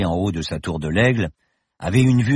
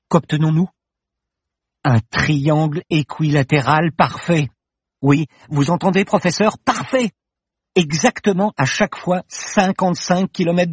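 ever higher and louder, triumphant; growing excitement; 8 semitones higher, 3 dB louder than usual. A narrator's reading. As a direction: build to a triumphant peak on "parfait". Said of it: "Paloma bafouilla, excitée".

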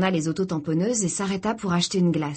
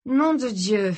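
higher, more open exclamation of dismay; raised-pitch curse; repeated curse; 4 semitones higher, 3 dB louder, slower than usual. A narrator's reading. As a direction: swear out loud in dismay. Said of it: "répéta-t-il".

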